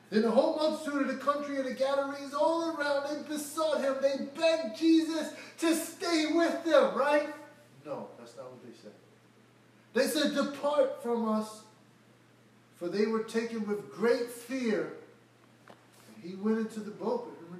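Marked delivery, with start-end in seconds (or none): 0.1-7.3 fervent preaching. Acted. Preaching at full force. 9.9-15.0 loud and higher, the begging line slow; fervent preaching. Acted. Preaching at full force.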